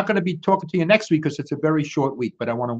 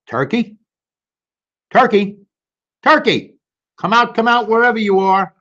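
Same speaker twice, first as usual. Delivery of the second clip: louder, higher calling through the barn; 7 semitones higher, 4 dB louder than usual. Acted. Farmer Jake calling for Turkey across the barn. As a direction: call out to find the hiding bird.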